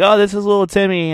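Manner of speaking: high, fast announcer voice; charity announcer. Retold or imitated. Mimicking the stadium announcer introducing a charity kid. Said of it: "and they're like".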